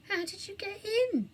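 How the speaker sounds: amazed, hoarse whispered question. A narrator's reading. Burglar amazed at the children, hoarse whisper. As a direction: gasp it out hoarsely in wonder. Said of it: "in a hoarse whisper of amazement".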